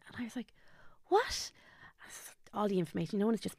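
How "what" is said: high-pitched, startled retold "what"; shocked disbelief. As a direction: blurt it out in stunned disbelief, pitched way up. Shocked reaction to stroke news.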